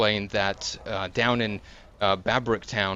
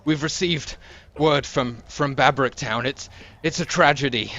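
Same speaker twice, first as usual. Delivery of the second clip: higher and louder, clinging and desperate; terrified guard; 5 semitones higher, 6 dB louder than usual. Acted. Guard clinging to the breastplate, terrified, begging for help. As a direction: plead like he is terrified. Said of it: "he says".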